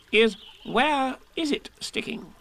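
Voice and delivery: high, slow fussy Rabbit. A narrator's reading. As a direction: Rabbit pondering importantly.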